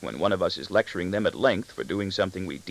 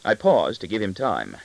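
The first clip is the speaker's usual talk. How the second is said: ordinary delivery, nothing marked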